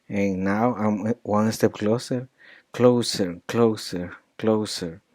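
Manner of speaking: "closer" con la R final bien marcada; hitting the R. Shown as a demonstration. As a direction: repite "closer" cerrando en una R clara. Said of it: "make sure that you hit it".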